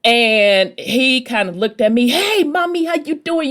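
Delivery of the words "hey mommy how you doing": much higher, slick flirty street greeting; New York charmer hollering a hello, pitched way up; slick New Yorker. Retold or imitated. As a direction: play the smooth New York guy calling out to a lady. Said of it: "how the New Yorkers are with that slick ass talk".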